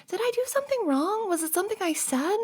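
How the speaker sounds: high, worried rising questions; anxious questioning. Acted. Asking a crying friend what went wrong, anxious questioning. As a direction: ask anxiously, voice climbing high.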